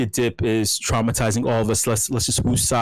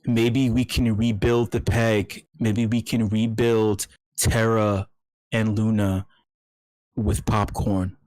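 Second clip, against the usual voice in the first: slow, hushed ASMR delivery; mock ASMR murmur; 3 semitones lower, slower than usual. Host playing ASMR artist to the chat, slow and hushed. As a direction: drawl it like a slow ASMR video. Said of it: "I'm still learning how to do A S M R".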